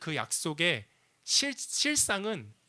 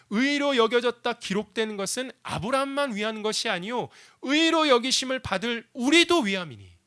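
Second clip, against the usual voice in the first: louder, higher, preaching the verse; emphatic repeat; 7 semitones higher, 6 dB louder than usual. Shown as a demonstration. Pastor re-preaching the verse to the congregation, forceful. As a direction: proclaim it with force. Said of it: "하나님이 우리에게 이 얘기하시는 거예요".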